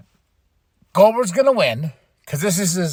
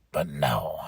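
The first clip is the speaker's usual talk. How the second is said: whispered, much softer and lower; whispered letdown; 9 dB quieter than usual. Real, not acted. Vlogger complaining about the finish, whispered disappointment.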